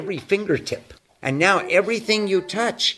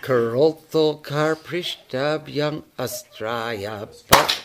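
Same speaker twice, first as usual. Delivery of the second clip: slow, each syllable drawn out in Karotho kar prishtabhyam astraya; over enunciating; slower than usual. Shown as a demonstration. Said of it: "one more time slowly".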